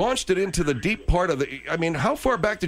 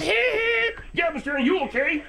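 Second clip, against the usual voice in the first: high mock voice; 12 semitones higher, slower than usual. Mocking the laugh, high voice.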